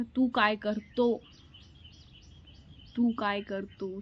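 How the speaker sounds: तू काय कर तो drawn out slowly, the informal तू said to a father-in-law; retelling her slow clumsy Marathi line, tू काय कर तो said slowly; slow careless Marathi. Retold or imitated. New bride unknowingly addressing father-in-law, slow informal Marathi. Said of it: "तो मैंने बोला था".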